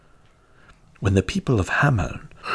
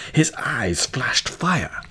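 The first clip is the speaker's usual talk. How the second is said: usual speaking voice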